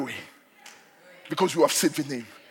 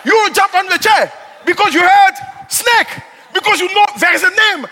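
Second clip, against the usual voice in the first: loud, high, pounding sermon delivery; fiery preaching; 12 semitones higher, 8 dB louder than usual. Acted. Pastor driving home the power of the name, full-force preaching. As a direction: preach it hard to the congregation.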